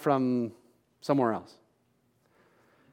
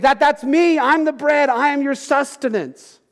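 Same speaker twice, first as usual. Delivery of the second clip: emphatic Jesus; 9 semitones higher than usual. Acted. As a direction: press the point home as Jesus. Jesus explains he is bread.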